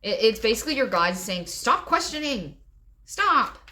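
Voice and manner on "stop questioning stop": high, loud mock order; emphatic high-pitched telling-off; exasperated command. Voices guides' command to listener.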